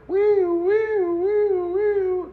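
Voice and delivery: high-pitched wailing "wee" siren noise; imitated ambulance siren. Hearing help arrive while hurt, imitated ambulance siren. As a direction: mimic a siren with long, very high wees. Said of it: "the ambulance come".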